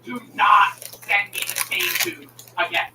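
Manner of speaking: "Do not" slow, each word set apart; firm emphatic delivery. Retold or imitated. Streamer retelling his demand to the supervisor, slow and emphatic. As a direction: spell out "Do not" slowly as an order. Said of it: "I say it like this".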